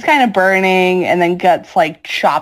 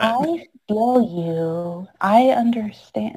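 very slow, softer fake comfort; mock soothing empath; 3 semitones higher, 5 dB quieter, slower than usual. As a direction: parody a gooey influencer.

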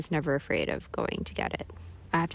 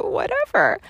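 squeaky self-mockery; 5 dB louder than usual. High squeaky self-mocking voice.

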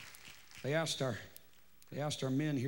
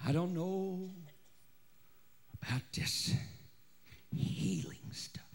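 whisper; 7 dB quieter than usual. Acted. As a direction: drop to a hushed, breathy whisper. Hushed confiding aside to congregation.

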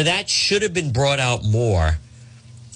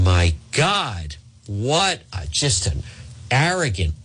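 ordinary talk, nothing marked

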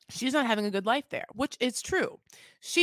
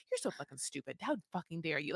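low, soft imitation of indignation; retold quiet outrage; 3 semitones lower, 8 dB quieter than usual. Imitating the wife answering her husband's insult, low and indignant. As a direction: act out a hushed, offended comeback. Retold quarrel, wife's angry reply.

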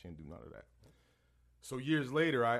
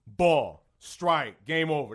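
loud punched calls, one by one; umpire's calls; 7 dB louder, much slower than usual. Retold or imitated. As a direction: call it out like an umpire. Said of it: "All you heard was".

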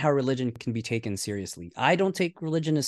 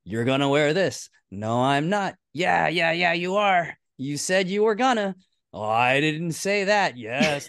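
higher-pitched back-and-forth squabble; mock bickering; 5 semitones higher, 3 dB louder than usual. Host re-enacts the couple fighting over clothes, mock bickering. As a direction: play both sides of a petty argument.

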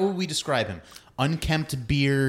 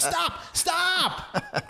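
screaming at the radio. Retold or imitated. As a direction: scream it at the phone in exasperation. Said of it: "I was, like, screaming at my phone".

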